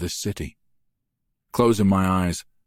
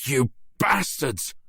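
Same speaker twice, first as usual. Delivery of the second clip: slow, raw, higher enraged cry; roar of rage; 8 semitones higher, slower than usual. A narrator's reading. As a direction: roar it at them in fury. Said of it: "My roar of rage".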